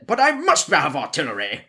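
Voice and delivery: lightly voiced pompous British general in an English accent; a hint of a stuffy British general demanding guns. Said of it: "the stereotype British general".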